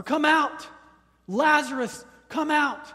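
high, loud repeated command; booming call, each out punched; yell. Retold or imitated. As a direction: shout him out of the grave. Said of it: "it was a yell".

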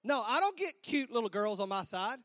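ordinary delivery

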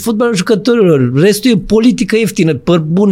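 high, sing-song mock of a pundit; mocking the commenter. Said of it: "și a scris".

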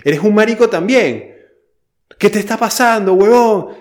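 loud, high shouted taunt; mock yelling. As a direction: hurl it up like a rude instructor. Mock exchange of insults.

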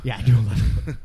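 laughing through the words. Real, not acted. Echoing his friend's yoga joke, laughing out loud. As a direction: crack up while repeating his joke.

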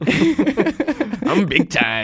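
high, drawn-out mock brag; mock boasting. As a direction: play at being a big shot, stretched and high. Playful mock boast about fancy seat.

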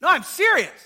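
normal talking voice

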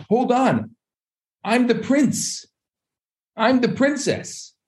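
high-pitched, drawn-out dawning awareness; sudden realization. Acted. Forgetful soul remembering its royal birth, amazed realization. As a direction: exclaim it high and slow, as if just remembering.

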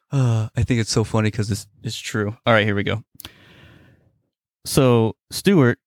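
ordinary delivery

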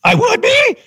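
high, incredulous shout of disbelief; surprised. Retold or imitated. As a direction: squeak out the surprise. His surprised reply to the coach.

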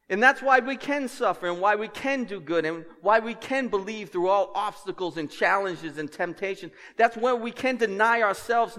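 fervent preacher's climb; preaching at full force. Acted. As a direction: drive it home to the congregation.

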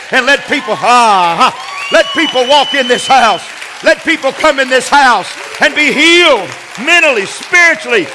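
high, loud, shouted preacher's plea; full-force preaching prayer. Real, not acted. Preacher praying for a healing station, full-force shouted preaching.

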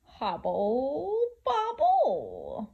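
slow chanting witch. A narrator's reading. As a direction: chant like a witch over a pot. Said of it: "chant the witches".

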